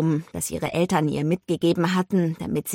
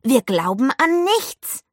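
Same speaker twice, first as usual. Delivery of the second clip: mild mock squawk, high and slow; imitated parrot screech; 8 semitones higher, 4 dB louder, slower than usual. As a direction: quote a screeching parrot, stretching the end. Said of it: "Er hat gekreischt". (nachgeahmtes Papageienkreischen, leicht angedeutet)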